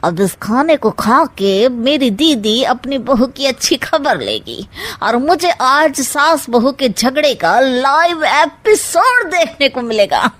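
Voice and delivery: usual speaking voice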